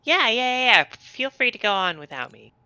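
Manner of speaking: normal talking voice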